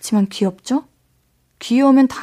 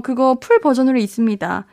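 ordinary talk, nothing marked

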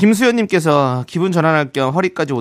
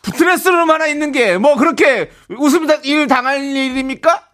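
high, loud hammed-up indignation; mock outrage; 10 semitones higher, 4 dB louder than usual. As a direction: rant as if your honour is hurt. Comic outburst over being laughed at.

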